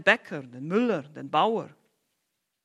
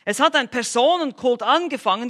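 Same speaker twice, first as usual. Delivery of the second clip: ordinary talk, nothing marked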